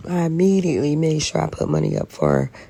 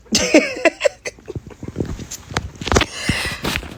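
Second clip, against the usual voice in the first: airy laugh, pitched up; high breathless laugh. Real, not acted. Woman laughing at her money troubles in despair. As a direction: let a helpless laugh out. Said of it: "I'm laughing".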